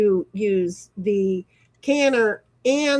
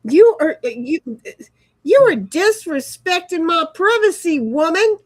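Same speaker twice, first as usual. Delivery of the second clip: high, louder offended scolding; indignant bird; 6 semitones higher, 5 dB louder than usual. Retold or imitated. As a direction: scold them like a bird whose privacy was invaded. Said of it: "He's like".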